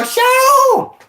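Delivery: very high, loud yell of "Show!"; excited high-pitched shout; shouted catchphrase. Retold or imitated. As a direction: belt it out like a party call. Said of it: "I was all".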